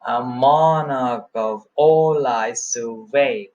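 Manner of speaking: slow verse, strong stress on MONARCH, ALL, SURVEY; anapestic beat. Shown as a demonstration. Teacher showing anapest in action, slow stressed recitation. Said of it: "Anapest in action".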